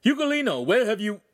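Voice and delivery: high-pitched shout, cut off abruptly. A narrator's reading. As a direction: call out sharply, then break off. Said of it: "The master shouted".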